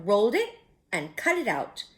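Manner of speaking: plain reading voice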